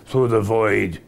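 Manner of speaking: low, menacing emperor's voice; Ming the Merciless. Retold or imitated. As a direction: loom over him as Ming. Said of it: "Ming says things like".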